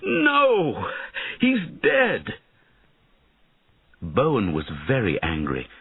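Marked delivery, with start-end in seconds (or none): 0.0-2.4 anguished cry. Acted. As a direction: cry out in horror at the death. Knight sees boy die.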